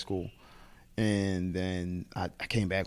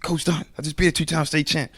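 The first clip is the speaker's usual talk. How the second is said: higher, eager announcement; excited news; 8 semitones higher, 3 dB louder than usual. Retold or imitated. Wrestler telling another coach, higher and proud. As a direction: proudly report the win. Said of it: "I said".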